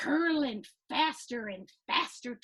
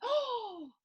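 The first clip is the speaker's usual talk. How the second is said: surprised; 9 semitones higher than usual. Acted. Gasp of surprise.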